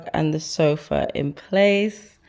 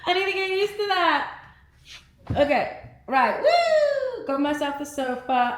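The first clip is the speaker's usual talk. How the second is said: excited; 13 semitones higher than usual. Real, not acted. Delight at the new sofa.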